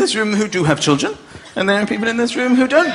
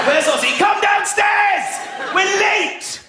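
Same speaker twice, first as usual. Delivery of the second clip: yelling for Ozzy; 10 semitones higher, slower than usual. Retold or imitated. Dad shouting for Ozzy to come down. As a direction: bellow for Ozzy, late.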